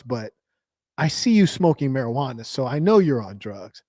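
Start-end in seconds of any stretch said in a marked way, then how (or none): none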